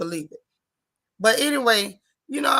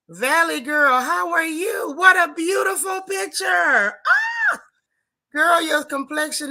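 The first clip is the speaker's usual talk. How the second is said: excited; 7 semitones higher, 4 dB louder, slower than usual. Real, not acted. Admiring a viewer's photo.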